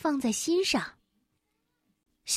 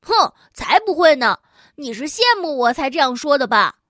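scornful huff; 7 dB louder than usual. Acted. Kangaroo snaps back.

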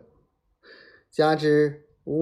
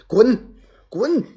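loud, high-pitched angry roar; 10 semitones higher, 9 dB louder than usual. A narrator's reading. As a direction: bellow it twice, each a sharp burst. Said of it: "连声吼道". (连声怒吼)

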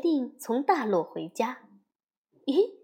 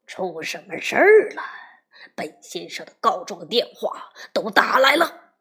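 louder, lower angry father's growl; roaring; 4 semitones lower, 5 dB louder than usual. A narrator's reading. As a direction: growl it like a furious big bear. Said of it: "两吨灰熊咆哮着说". (咆哮着怒问)

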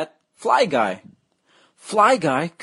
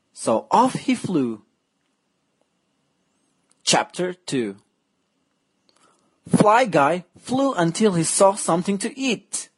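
ordinary delivery

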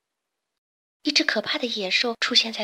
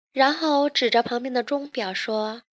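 plain reading voice

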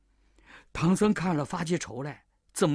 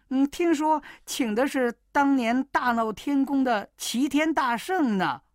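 higher, coy bride voice; pitched-up disguise; girl's voice; 6 semitones higher than usual. Acted. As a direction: tease him as the young wife. Wukong still posing as daughter.

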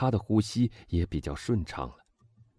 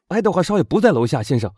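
loud, quick, higher-pitched servant; servant's answer; 9 semitones higher, 8 dB louder than usual. A narrator's reading. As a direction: report urgently to your master. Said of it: "仆人答道". (仆人急促地大声回答)

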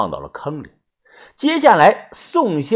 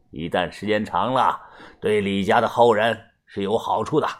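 low, softer voice of a feng shui master; geomancer explaining; 6 semitones lower, 6 dB quieter than usual. A narrator's reading. Mr. Song tells of the grave's good fortune, low voice. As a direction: drop low and explain it confidingly.

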